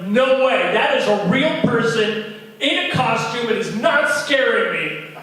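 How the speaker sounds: loud, high stubborn yell; child shouting his defiance; defiant kid. Retold or imitated. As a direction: insist loudly you are not scared.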